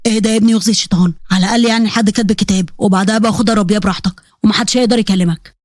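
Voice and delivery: put-on low-pitched older man, talking fast at first; groom's scheming father. Father calming his son at the wedding, low and coaxing.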